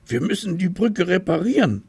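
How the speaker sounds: a small tiger taking charge; busy little tiger. A narrator's reading. Little tiger deciding to fix the bridge, high quick voice.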